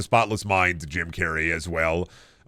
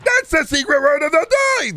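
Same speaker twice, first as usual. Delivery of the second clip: very high, loud, nasal-sounding cartoon shout; Pee Wee Herman impression; 5 dB louder than usual. Shown as a demonstration. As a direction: belt it like a manic kids' TV host. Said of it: "a little bit of a Pee Wee Herman voice".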